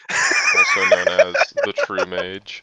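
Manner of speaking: very high, loud mock fanfare; grand announcer voice.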